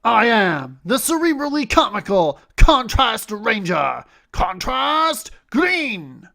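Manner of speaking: loud high heroic roll call; bright magical girl. Acted. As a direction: proclaim it like a hero's transformation. Transformation roll call pose.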